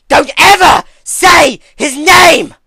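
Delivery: ferocious screamed warning; vicious scream. A narrator's reading. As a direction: let it rip in fury. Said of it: "She screamed viciously".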